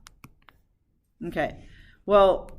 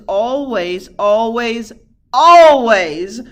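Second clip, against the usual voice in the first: "always" drawn out, louder each time; emphatic repetition; 11 dB louder, much slower than usual. Real, not acted. Teacher warns class about an easy lost point, "always" building louder. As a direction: build to a booming final "always".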